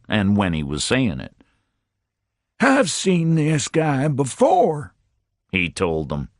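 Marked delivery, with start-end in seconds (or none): 2.6-4.9 high, slow, announcing voice; high, slow self-important chief; pompous fat chief. A narrator's reading. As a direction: make sure the cops hear it, high and drawn out. Fat chief tells the cops.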